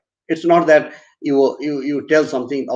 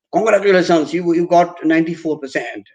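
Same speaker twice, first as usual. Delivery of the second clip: higher-pitched, upbeat congratulations; congratulating; 4 semitones higher than usual. Shown as a demonstration. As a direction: share good exam news brightly, pitch lifted. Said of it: "then you would say".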